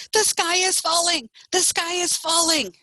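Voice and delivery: high-pitched insistent chicken alarm; high alarmed cry. A narrator's reading. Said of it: "Chicken Little was not listening".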